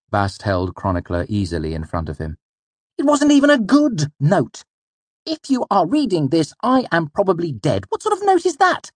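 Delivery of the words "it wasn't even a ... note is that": high, loud, outraged voice; indignant complaint. A narrator's reading. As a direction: complain in exasperated disbelief, peaking on the last question. Angry complaint about the note.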